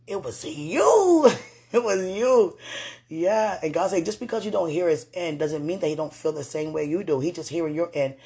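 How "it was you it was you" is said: high, slow crying laugh. Real, not acted. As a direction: break into a tearful laugh.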